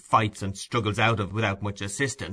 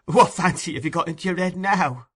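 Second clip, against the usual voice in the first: Nancy's voice; 8 semitones higher, 3 dB louder than usual. A narrator's reading.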